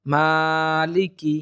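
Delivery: "মালিকি" slow, long "maa", short "ki"; correct tajweed. Shown as a demonstration. Teacher modelling the one-alif stretch, careful tajweed. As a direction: stretch only the first syllable of "মালিকি". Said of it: "সহিভাবে উচ্চারণটা হবে".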